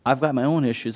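ordinary delivery

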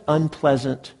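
ordinary talk

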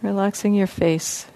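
soft, even voice with long pauses; guided meditation. Real, not acted. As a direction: gently guide listeners into relaxing, leaving space between phrases. Leads a guided body-scan meditation.

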